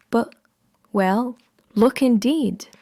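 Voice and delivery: plain reading voice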